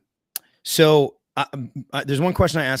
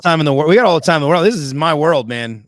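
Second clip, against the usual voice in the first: loud, higher-pitched burst; exuberant reassurance; 5 semitones higher, 10 dB louder, faster than usual. Real, not acted. Host telling guest there's all the time, exuberant reassurance. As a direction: jump in loudly, no rush at all.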